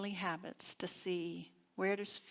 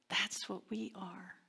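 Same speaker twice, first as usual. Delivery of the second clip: whispered; 4 dB quieter than usual. Real, not acted. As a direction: whisper it softly to the congregation. Hushed point in a sermon.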